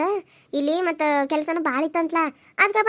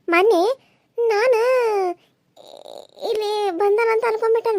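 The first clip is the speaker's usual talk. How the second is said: crying; 3 semitones higher than usual. Acted. Niece tells of mama crying.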